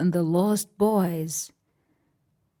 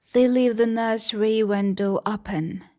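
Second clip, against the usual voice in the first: plain reading voice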